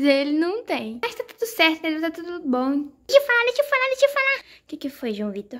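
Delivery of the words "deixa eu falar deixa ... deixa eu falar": put-on high, loud pushy child; high shouty kid voice; insistent kid. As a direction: demand a turn like an impatient toddler. Kid demands to speak.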